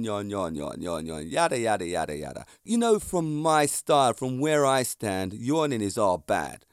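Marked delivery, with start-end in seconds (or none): 0.0-2.5 mock drowsy drone. Mocking droning yawners.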